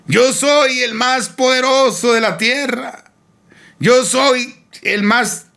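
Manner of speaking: slow, very high proclamation; imitated boastful shout. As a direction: cry it out slowly like a man boasting from a mountaintop. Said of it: "cuando comenzó a gritar". (grito jactancioso imitado, lento y agudo)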